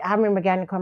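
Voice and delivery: ordinary talk, nothing marked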